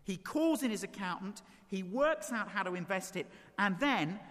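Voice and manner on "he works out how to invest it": usual speaking voice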